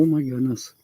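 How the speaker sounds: normal talking voice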